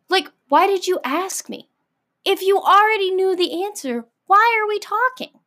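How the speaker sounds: high-pitched, incredulous questioning; exasperated. Acted. Venting at imagined corrector.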